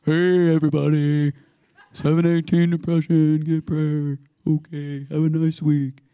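drawn-out monotone mock announcement. Preacher imitating himself relaying God's prompt, droning mock announcement. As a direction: call it out all on one note, sending yourself up.

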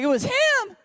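very high, loud imitation of a weeping woman; mock tearful cry. Weeping church lady pointing out who prophesied, mock tearful cry. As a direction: wail it out, hammed-up. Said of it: "older lady in our church is just weeping".

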